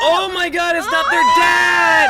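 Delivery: high, loud shriek of mock-horror at the twist; big scandalised yell; shocked outburst. Real, not acted. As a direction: shout it out, stretching the last words. Listener reacts to plot twist.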